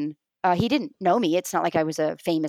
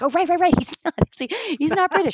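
high, bright realization, voice jumping up; mock realization; 9 semitones higher, faster than usual. Remembering the video, a high, amused sudden realization. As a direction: act out the penny dropping. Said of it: "I was like".

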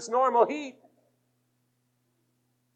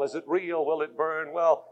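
ordinary talk, nothing marked

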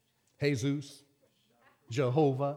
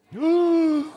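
mock gasp; 7 semitones higher, 4 dB louder than usual. Mock fright.

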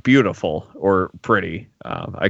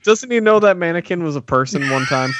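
mock outrage; 8 semitones higher than usual. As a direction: play up fake outrage for a laugh.

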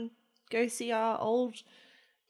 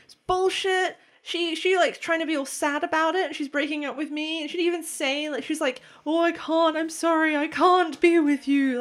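higher, a touch of outrage; mock indignant rant; 6 semitones higher than usual. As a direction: vent like the angry friend.

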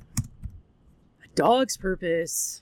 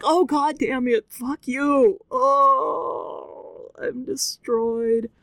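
higher-pitched tearful wail, retold; anguished outburst; 5 semitones higher, much slower than usual. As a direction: wail it in mock heartbreak, dragging the end. Retold anguished reaction to corgi's line.

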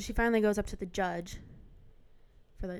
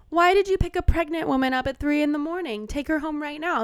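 raised, higher voice of an angry judge demanding answers; stern judge scolding; 7 semitones higher, 5 dB louder than usual. Retold or imitated. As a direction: tell the cops off from the bench. Judge scolding the police officers.

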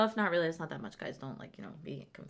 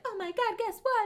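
excited friend; 13 semitones higher than usual. Retold or imitated. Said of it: "she was like".

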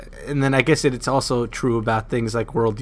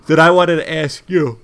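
talking through a yawn; words stretched by a yawn; 4 semitones higher, 7 dB louder than usual. Real, not acted. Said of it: "as I yawn".

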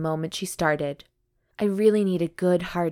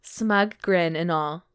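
normal talking voice

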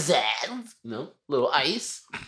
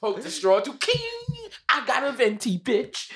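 much higher, slow, hammed-up sassy voice; mock squeaky customer; slower than usual. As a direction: squeak it out like a tipsy customer bragging. Acting a drunk Starbucks customer.

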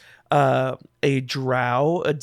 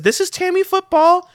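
put-on much higher-pitched young goblin voice; goblin greeting; 5 dB louder than usual. Goblin introduces himself to the party, high and polite. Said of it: "and he says".

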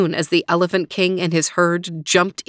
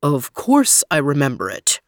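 lower, stately elephant voice; elephant king; 4 semitones lower than usual. Acted. Elephant King answers the mouse, low and stately. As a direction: speak as a big calm king. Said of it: "said the Elephant King".

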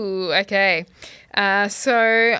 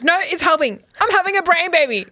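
excited Jake; 8 semitones higher, 4 dB louder than usual. Retold or imitated. Quoting Jake's excited sitcom line.

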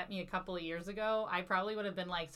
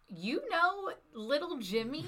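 put-on slow, higher, teasing voice of an old counselor; imitating Marlon; 8 semitones higher, 3 dB louder, slower than usual. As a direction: drawl it out like a fond old mentor. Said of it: "he just goes".

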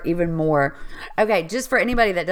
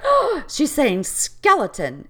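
higher, drawn-out dawning discovery; retold sudden realization; 7 semitones higher, slower than usual. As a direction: gasp and stretch out the discovery. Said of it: "I was like".

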